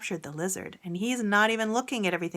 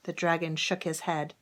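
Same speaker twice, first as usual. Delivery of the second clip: usual speaking voice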